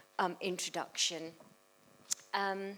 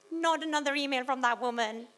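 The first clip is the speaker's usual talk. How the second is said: high, loud exasperated wail; mock scream; 9 semitones higher, 7 dB louder than usual. Organiser woken at two A M by emails, mock screaming. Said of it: "at two A M screaming".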